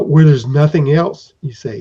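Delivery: usual speaking voice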